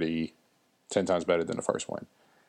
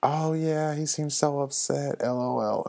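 mock wife voice; 7 semitones higher than usual. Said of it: "she was like".

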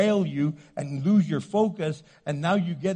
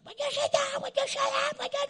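much higher, squabbling couple at the table; a nagging spouse, pitched way up; mock restaurant quarrel; faster than usual. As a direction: act out the couple snapping at each other in public.

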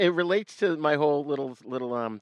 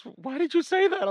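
put-on quoting girlfriend; 4 dB louder than usual. Girlfriend scolding him after.

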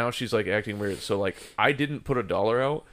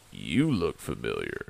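echoed recognition; 12 semitones lower, 4 dB quieter than usual. Retold or imitated. Man answering the stripper who recognised him, low echo. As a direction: drop the voice low and echo her. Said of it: "I was like".

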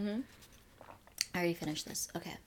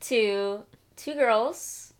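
slow announcer intro; podcast intro; 3 semitones higher, much slower than usual. Acted. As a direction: draw out the show title.

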